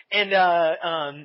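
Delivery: ordinary talk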